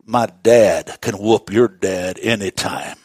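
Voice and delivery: low, slow schoolyard brag; unhurried, mock-tough one-upmanship; playful boast. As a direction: boast coolly, dropping the pitch. Daniel voiced as a boasting child.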